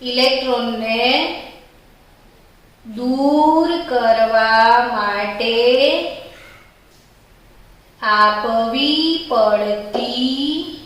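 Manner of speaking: very slow, word by word for writing; slow, spaced-out dictation. Shown as a demonstration. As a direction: dictate slowly so students can write. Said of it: "અચ્છા, તો લખીશ જ".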